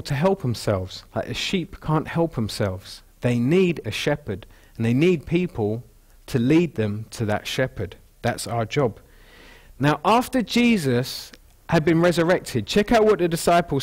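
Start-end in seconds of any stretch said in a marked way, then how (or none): none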